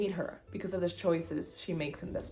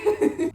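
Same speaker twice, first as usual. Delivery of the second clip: delighted laugh; 8 dB louder than usual. Real, not acted. Laughs at Aphrodite's line.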